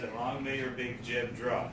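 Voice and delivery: slow teaching model of the phrase. Shown as a demonstration. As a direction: spell it out slowly for learners to repeat. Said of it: "I will slow it down for you".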